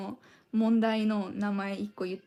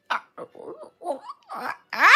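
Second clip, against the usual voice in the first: very high squeezed squeak; rubber chicken voice; 7 semitones higher, 4 dB louder, much slower than usual. Retold or imitated. Doing the rubber chicken's voice through a laugh, squeaky. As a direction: squeeze the throat for a toy squeak. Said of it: "I'm like".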